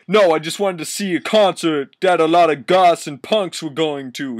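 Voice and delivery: put-on character voice. Reading fanfic to a friend, mocking the boy's voice. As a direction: play the boy's line big and mocking.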